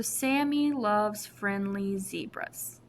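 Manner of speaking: slow, careful read of the mnemonic. Shown as a demonstration. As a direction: read it slowly so kids catch each word. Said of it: "You'll notice S".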